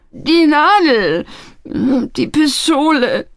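high, slow, tearful wail; weeping lad confessing; sobbing. A narrator's reading. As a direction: sob out each item, stretched out. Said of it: "schluchzte er".

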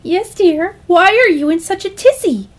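high, lilting motherly voice; high-pitched, fussing mother character; doting mother. Acted. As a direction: coo it like an unworried mom.

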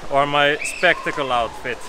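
ordinary delivery

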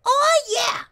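very high, loud excited yell; huge high "Oh, yeah!" shout; Rainbow Dash shout. Retold or imitated. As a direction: belt it out like a hyped tomboy pony. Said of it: "she says".

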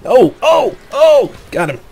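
high, loud excited shouts; excited yelling. Real, not acted. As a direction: yell with real thrill. Big midair kill in game.

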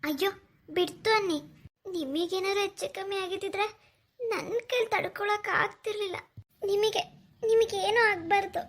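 high-pitched, welling up with tears; tearful. Acted. Woman dreading harm to the man she cares for, tearful. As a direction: plead through rising tears. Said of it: "ಯಾಕ್ ಕಣ್ಣೀರಾಗ್ತಿದ್ಯಾ?".

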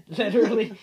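said through a loud laugh; laughing hard at the punchline. Real, not acted. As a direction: burst out laughing on the word. Laughing at own joke.

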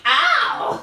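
a playful fake ouch; mock ow. Mock headbutt pain.

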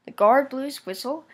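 ordinary delivery, nothing marked

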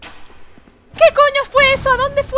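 high, loud, drawn-out alarm; shocked shout. Real, not acted. Player losing track of the boss, shouting in shock. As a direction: shout in disbelief at the vanished boss.

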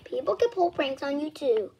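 ordinary delivery

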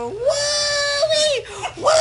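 long, high "woo" ending in a laugh; high whooping. Real, not acted. Patient loses the thread and whoops, high and long. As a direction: let out drawn-out high whoops.

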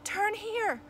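high, urgent call to the driver; shouted direction. A narrator's reading. Navigator calling out directions to driver.

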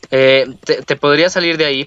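usual speaking voice

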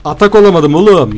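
frustrated outburst. Real, not acted.

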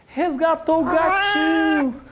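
high, slow, tender; cooing. Real, not acted. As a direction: gush over a rescued kitten. Gushing over cute note.

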